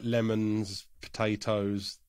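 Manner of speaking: ordinary talk, nothing marked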